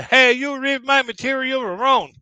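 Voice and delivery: loud, high, slow call from the audience. Retold or imitated. Heckler in the audience calling out at the comedian, loud and high. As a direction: shout it up at the stage, offended.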